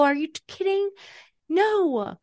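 high, loud, incredulous gushing; over-eager reassuring parent. Retold or imitated. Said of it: "we're so inclined to be like".

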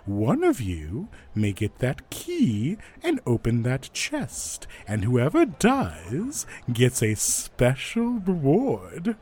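showman laying out the rules, slow swooping emphasis; announcer teasing, dropping off at the end; loudspeaker announcer. Acted. Announcer explains the quest to the challengers, drawn-out showman voice. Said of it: "you hear a voice over a loudspeaker".

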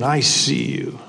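a creepy disembodied voice in the dark; parrot voice. Acted. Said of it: "he hears it again".

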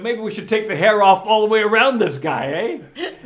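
loud playful suggestion from a movie star; De Niro's loud suggestion. Retold or imitated. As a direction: boom it out like a star's offhand idea.

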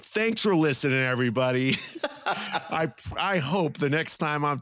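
high, bright laughing goodbye; laughing sign-off. Real, not acted. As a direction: laugh through the thanks. Sign-off laughing after news.

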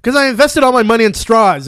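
loud, high proud confession; proud reveal. Retold or imitated. Conan worker explains his straws, loud and high. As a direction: announce it like a triumphant punchline. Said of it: "he's like".

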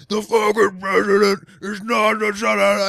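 loud, slow, furious mock shout; mock screaming dad. Ex-girlfriend's Italian father yelling at the table, slow loud shout. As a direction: bellow it like a red-faced father. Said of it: "they'll be screaming at you".

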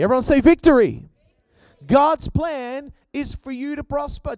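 loud, rising, emphatic preacher delivery; preaching at full force. Real, not acted.

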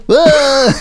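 high mock scream of falling; hammed-up fall scream. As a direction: yelp it like jumping off a roof. Character screams jumping off roof.

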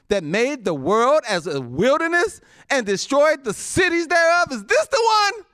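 high, loud rising sneer; scornful reading. Acted. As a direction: build up the mockery.

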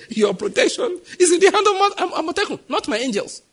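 mock the reliance on Amotekun, laughing. Preacher voicing God's teasing rebuke, laughing. God voiced, laughing, mocking.

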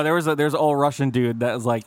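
ordinary talk

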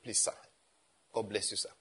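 soft, low imitation of a respectful giver; humble offering. Young member discreetly handing money to a pastor, humble and hushed. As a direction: murmur it deferentially, low and soft.

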